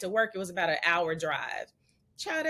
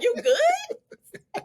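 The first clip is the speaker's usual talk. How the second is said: much higher, laughing squeak; mocking husband; 5 dB louder than usual. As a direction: tease him with a squeaky quote. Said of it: "You like".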